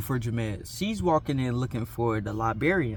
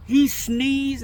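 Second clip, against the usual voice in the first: exasperated disbelief; 11 semitones higher, 4 dB louder than usual. Real, not acted. Disbelief at the sneeze.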